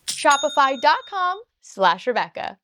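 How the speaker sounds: loud, upbeat sponsor read. Acted. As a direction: pitch the sponsor with energy. Repeated URL in ad.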